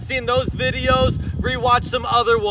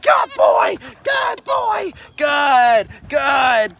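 very high praise to a dog tugging; excited dog praise; 3 semitones higher, much slower than usual. Real, not acted. Praising dog biting tug toy.

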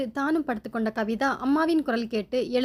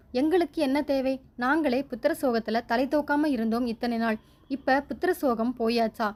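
usual speaking voice

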